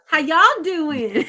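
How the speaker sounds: slow, drawled Texas greeting with a laugh; Southern twang. Shown as a demonstration. Co-host showing off her country twang with a laughing drawl. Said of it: "See, I do it like y'all now".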